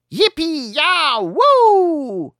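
put-on high whooping wolf-kid howl. Wolf kids bursting from a hole, howling loud. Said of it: "heulen die Wölfis zusammen".

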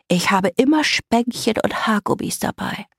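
put-on congested little-girl voice; stuffed-nose girl. A narrator's reading. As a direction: chirp it through a blocked nose. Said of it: "Ihre Stimme klang, als wäre ihre Nase verstopft".

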